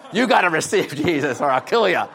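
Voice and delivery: high, loud hammed-up threat; mock threatening shout. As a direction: play a gang convert yelling an ultimatum. Acted threat from converted gang leader.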